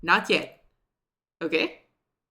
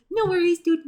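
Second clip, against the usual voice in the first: excited; 11 semitones higher, 7 dB louder than usual. Real, not acted. Replying to a chat message.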